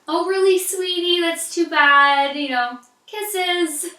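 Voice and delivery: patronize the hater with sugary pity; mock sweet sarcasm. Replying to a hater's bad comment, mock-sweet and condescending. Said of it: "you know, like a".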